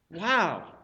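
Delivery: normal talking voice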